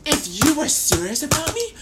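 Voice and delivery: high, loud indignant outburst; indignant challenge. Acted. Storyteller challenging T A for not breaking up, indignant. Acted quarrel over breaking up.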